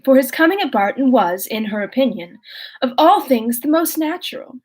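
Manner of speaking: reading a classic novel aloud, even and clear; story narration. A narrator's reading. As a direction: read the chapter to listeners.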